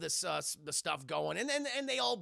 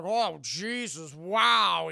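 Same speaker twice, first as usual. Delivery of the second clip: slow, louder stunned gasp of words; mock shock; 9 dB louder, much slower than usual. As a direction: sound taken aback. Wife's shocked quote.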